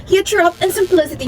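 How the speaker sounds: ordinary delivery, nothing marked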